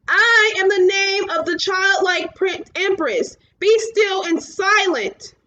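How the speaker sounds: high, loud heroic shout. A narrator's reading. Atreyu commands the wind giants, shouting loud. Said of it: "shouted at the top of his lungs".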